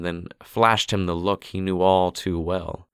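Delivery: usual speaking voice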